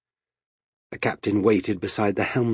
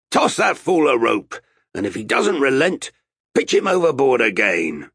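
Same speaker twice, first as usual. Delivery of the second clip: raised, higher commanding voice; barked order; 5 semitones higher, 5 dB louder than usual. A narrator's reading. Captain ordering Vasco about the sailor, loud command. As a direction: call out the order with impatience.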